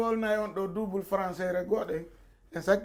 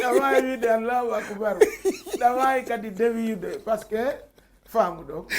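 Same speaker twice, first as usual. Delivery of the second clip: laughing; 2 semitones higher, 6 dB louder than usual. Real, not acted. Laughing through the story.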